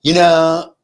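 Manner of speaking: loud, drawn-out skit-like gossip voice; mock neighbor talk. Neighbor exclaiming to her friend, mockingly affected. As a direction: exaggerate a vapid neighbor's exclamation. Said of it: "like a Saturday Night Live skit".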